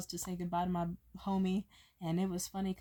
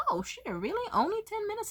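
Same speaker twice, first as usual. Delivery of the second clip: surprised; 13 semitones higher than usual. Retold or imitated. Surprised retort quoted.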